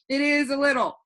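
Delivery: put-on a cheerful know-it-all boy floating in the air; voiced boy Alec.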